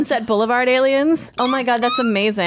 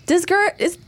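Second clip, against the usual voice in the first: higher, mock worried asking; mock concern; 8 semitones higher than usual. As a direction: pretend to fret over him. Mock worried question about Durgo.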